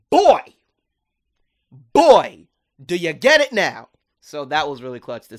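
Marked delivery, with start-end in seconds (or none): 0.1-3.9 put-on loud, high exasperated "boy, boy"; exasperated shout. Luz yelling at Ida to finally get it. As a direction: yell it like she's finally had enough. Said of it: "Luz is just like".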